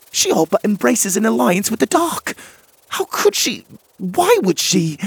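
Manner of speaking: high, loud shocked outburst; outraged fairy. Acted. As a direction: fling out the disbelief, voice leaping high. Em outraged after audience.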